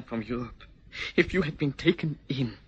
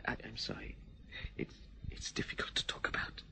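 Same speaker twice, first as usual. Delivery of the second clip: barely audible, overcome; whispering apology, too moved to speak; choked whisper; 3 semitones lower, 14 dB quieter than usual. Acted. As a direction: fade to a broken whisper, voice failing. Breaks off, overcome by memories.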